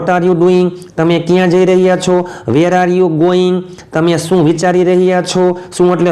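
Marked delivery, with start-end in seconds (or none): 2.5-3.6 slow dictation. Shown as a demonstration. Teacher models English sentence slowly.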